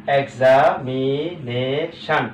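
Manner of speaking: "Examination" slow, each syllable drawn out. Shown as a demonstration. Teacher showing how the long word divides, syllable by syllable. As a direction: say "examination" in separate stretched parts. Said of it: "ভাগ করা অত্যন্ত".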